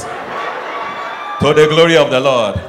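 slow drawn-out shout of praise; preachy shout. Acted. Preacher to husbands washing plates, slow shout. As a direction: proclaim it like a hymn.

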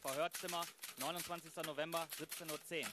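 tiefe, ruhige Ansagerstimme für Ort und Zeit; Szenenansage. Acted. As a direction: sachlich angesagt wie ein Filmtitel. (Sprecher kündigt die Verhörszene an, tiefe Ansage)

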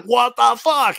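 high-pitched, stunned exclamation; shocked. Retold or imitated. Billy being introduced to Chappelle, imitated shock. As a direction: mimic a stunned double take. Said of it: "little shocking moment".

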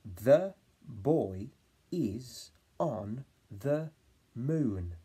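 put-on the boy, is on the, moon in chunks; word by word. Shown as a demonstration. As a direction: say it in spaced chunks. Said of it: "my turn".

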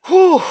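high, loud exhale of disbelief; loud whew. Real, not acted. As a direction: blow out a big whew. Reaction after brutal friend zone.

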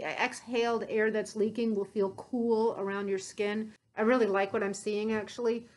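muffled behind respirator; speaking through filter mask; through a face mask. Real, not acted.

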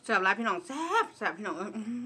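words between bites; talking while chewing. Real, not acted. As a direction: mouth partly full of food. Seen 2: Food enters mouth at -2; lips keep working through early words.

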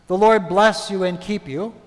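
normal talking voice

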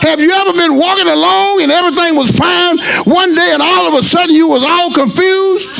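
rising, shouted preaching, slowing down; preaching at full force. Acted. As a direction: build the sermon into a cry.

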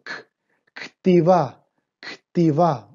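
ktivá: a clear K with no vowel after it; ktivá with a sounded K. Shown as a demonstration. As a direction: say K, then ktivá, K joined straight to T. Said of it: "Ojo con esta pronunciación". (di ktivá haciendo sonar la K sin vocal)

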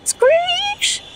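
high squealing screech of brakes. A narrator's reading. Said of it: "Go the brakes".

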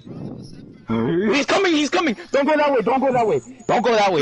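high frantic shouting; panicked warning. Real, not acted. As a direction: yell a scared warning. Warning teammate of killer.

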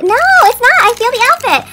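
high, loud relieved cry; loud thrilled denial; excited shout. Real, not acted. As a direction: shout the good news. Relieved not a duplicate.

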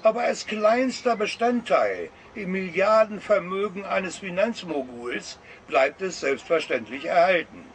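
ordinary talk, nothing marked

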